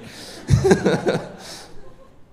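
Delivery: high breathy burst of laughter. Real, not acted. Pastor laughs at his own woo.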